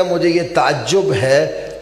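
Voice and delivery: ordinary talk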